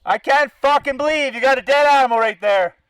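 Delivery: ordinary delivery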